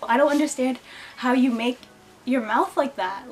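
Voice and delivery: ordinary talk, nothing marked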